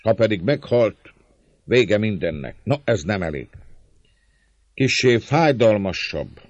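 hangos, magasabb szónoki hang; harsányan szólj a bosszúra éhes tömeghez; népszónok. A narrator's reading. (szónokolva, emelt hangon)

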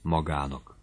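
understated sad aside. A narrator's reading. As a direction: say it softly and sadly. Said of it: "hozzátette szomorúan".

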